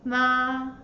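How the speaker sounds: "Ma" held long on a flat, level pitch; "Ma" sustained without any rise or fall; first tone. Shown as a demonstration. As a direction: say "Ma" in Mandarin first tone, perfectly level. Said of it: "The first tone is flat".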